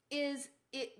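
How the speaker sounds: normal talking voice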